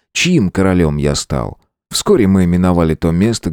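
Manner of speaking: ordinary delivery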